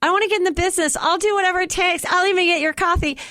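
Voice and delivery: squeaky eager wannabe; eager fan voice. Retold or imitated. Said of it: "people Facebook me and are like".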